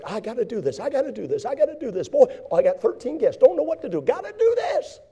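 high, rising frantic fuss over chores; frantic Martha. Acted. Preacher playing Martha swamped with guests, frantic high voice.

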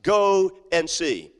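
slow, weighty refrain; slow emphatic. Acted. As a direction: lean into each word. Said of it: "the very first response ... was this".

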